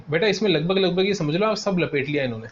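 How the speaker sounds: normal talking voice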